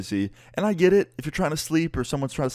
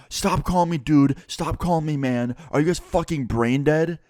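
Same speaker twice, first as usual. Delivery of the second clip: higher-pitched mock berating; exasperated angry neighbor; 6 semitones higher than usual. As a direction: scold them as an irate neighbor, acted out. Retold furious neighbor berating them.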